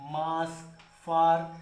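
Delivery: ordinary talk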